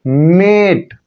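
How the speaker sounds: मेट with the ए stretched long; long drawn-out vowel. Shown as a demonstration. Teacher showing learners the silent-E word, मेट drawn out long. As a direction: hold the vowel in मेट, drawing it out. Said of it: "लंबा खींचेंगे".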